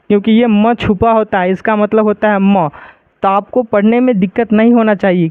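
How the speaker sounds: ordinary talk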